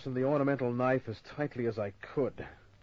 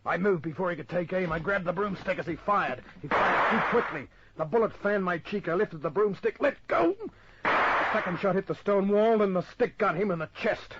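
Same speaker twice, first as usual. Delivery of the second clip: higher, louder, urgent telling of the fight; tense action narration; 9 semitones higher, 6 dB louder than usual. A narrator's reading. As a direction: race through the struggle breathlessly.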